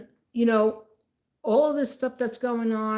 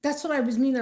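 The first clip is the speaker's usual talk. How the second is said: higher, pleading woman's excuse; flustered excuse; 7 semitones higher, 3 dB louder than usual. Retold or imitated. Vlogger imitating the woman explaining the gum, higher pitched. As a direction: play her high and eager to explain. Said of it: "she goes".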